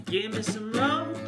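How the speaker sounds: normal talking voice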